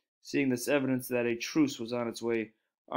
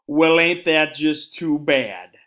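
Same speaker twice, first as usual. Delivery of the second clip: slow, louder sarcastic cowboy drawl; 8 dB louder, much slower than usual. A narrator's reading. Cowboy Boone mocking Little Bear, sarcastic. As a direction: drip scorn on every word. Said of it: "said Boone sarcastically".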